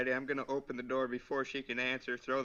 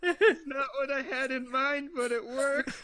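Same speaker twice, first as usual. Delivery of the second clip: laughing while speaking; giggling through the words; 11 semitones higher, 5 dB louder than usual. Real, not acted. Player laughing at the outcome.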